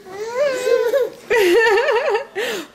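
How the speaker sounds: squealing laugh; high delighted laugh. Real, not acted.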